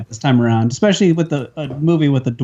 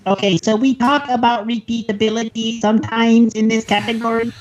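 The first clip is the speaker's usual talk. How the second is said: robot voice; 7 semitones higher than usual. Pretend. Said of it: "That's like my fake robot voice".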